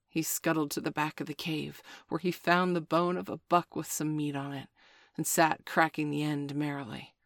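ordinary delivery

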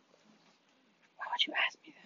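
hushed, very slow whisper; whispered complaint. Real, not acted. Friend complains to her companion about being asked, whispering. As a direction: whisper it, dragging the words out. Whispered aside to the other player.

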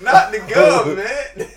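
high laughing protest. Real, not acted. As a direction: laugh through the words. Laughing protest at real name.

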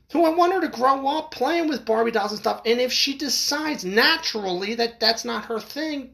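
real angry rant, pitched high throughout; worked up. Real, not acted. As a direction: tear into them, voice riding high. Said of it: "I get very worked up over this".

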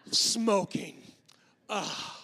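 loud, scornful burst, then a disgusted groan; hammed-up disapproval; mock disgust. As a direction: spit it out in exaggerated revulsion. Hypocrite's disgust at smokers, acted.

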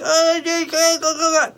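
long, high-pitched mock cry; drawn-out imitation of a screaming baby; imitated baby wail. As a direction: wail like a hungry infant, pretending. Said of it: "crying and screaming".

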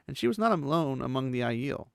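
ordinary delivery, nothing marked

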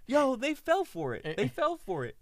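high, loud, stretched-out protest; mock excited insistence. Friend insisting the cover story worked, mock excitement.

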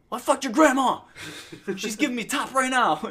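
loud, high taunting holler; imitating a yelling lobby rival; mock trash talk. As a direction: shout it to outdo the other gamer. Acts out rival's escalating trash talk.

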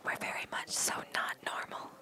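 soft breathy whisper. Pretend. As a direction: hush it like ASMR. Whispering for ASMR.

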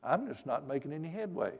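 low, soft retold grumble; quietly lament, as if retelling a private prayer; mild complaint to God. Preacher retells praying to God while driving home, mildly complaining. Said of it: "kinda complaining to God".

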